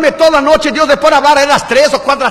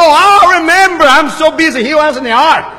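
joking God remembering; 5 semitones higher, slower than usual. Pretend. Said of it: "Dios se acordó".

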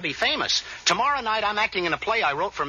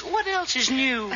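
slow, higher mock English lady; nonchalant English wife; 5 semitones higher, slower than usual. Joker mimics the film wife's reply, nonchalant and slow. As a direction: play her utterly unruffled, drawn out. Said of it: "And she says".

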